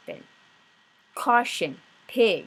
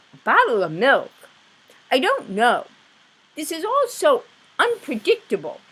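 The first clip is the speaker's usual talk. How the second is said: louder, higher fluster; flustered Mr Watson; 8 semitones higher, 7 dB louder than usual. Acted. Husband baffled by milk idea, loud and flustered. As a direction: sound baffled and thrown.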